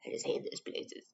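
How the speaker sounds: soft, whispered confession; whispered aside. Real, not acted. Presenter privately confiding dislike of big stores, whispered aside. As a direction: drop to a whisper, off the record.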